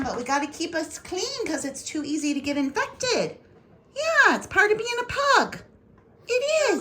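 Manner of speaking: high cooing dog voice; pet talk. Real, not acted. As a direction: pitch up and coo at the pug. Telling pug it's pug life.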